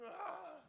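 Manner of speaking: high mock groan of disgust. Body surfer bites a sandy sandwich, mock disgusted groan. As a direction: gag out a high "ah".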